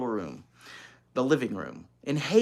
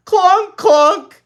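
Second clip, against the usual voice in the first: very high, loud yelled clonks; 18 dB louder than usual. Acted. Little girl yelling machine noises. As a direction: yell it like a pile driver. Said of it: "yelled Ramona".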